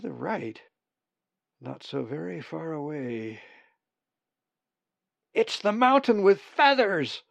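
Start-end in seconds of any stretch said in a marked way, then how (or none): 5.3-7.2 high, loud, elated raven cry; happy croaking. A narrator's reading. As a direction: croak it out with joy. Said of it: "the sound of his voice croaking happily".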